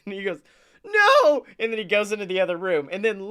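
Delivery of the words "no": put-on high, loud kid's refusal; mock defiant shout. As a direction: imitate a boy yelling in protest. Retelling the boy's angry refusal.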